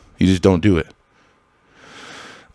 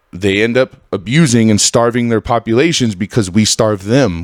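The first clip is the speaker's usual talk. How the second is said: ordinary talk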